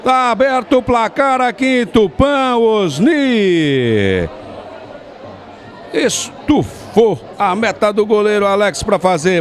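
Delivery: soaring high shout for the goal; explosive goal commentary. Real, not acted. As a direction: erupt as the ball hits the net. Goal scored, narrator erupts. (narração de gol explosiva)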